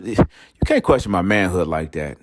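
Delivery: ordinary talk, nothing marked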